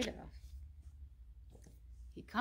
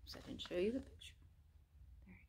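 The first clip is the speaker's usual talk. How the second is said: quick whispered aside; whisper, hurried; 14 dB quieter, much faster than usual. Real, not acted.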